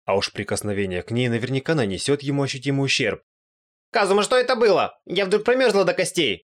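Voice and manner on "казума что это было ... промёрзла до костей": high, loud, frightened wail; panicked Aqua. A narrator's reading. Аква напугана холодом. (испуганный высокий вопль Аквы)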